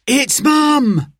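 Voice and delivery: put-on high, thrilled child's cry; excited shout. A narrator's reading. Boy spots Mum's car, excited high shout. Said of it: "shouted Simon".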